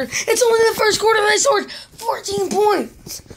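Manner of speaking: high-pitched frantic insistence; frantic yelling. Real, not acted. Gamer reassuring himself after a bad play, frantic high yell. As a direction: yell it, voice pushed way up. Gamer raging, then told to quiet down.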